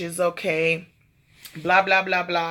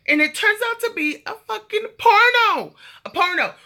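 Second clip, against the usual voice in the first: outraged surprise at what she saw; shocked; 14 semitones higher, 5 dB louder than usual. Real, not acted. Telling her viewers about the nail tech's video, high shocked outburst. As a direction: voice jumping high in disbelief.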